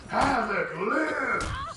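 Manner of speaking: high, drawn-out mock-triumphant cry; triumphant shout. Viewer announcing Havoc is not asleep after all, triumphant shout. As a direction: crow it loud and long.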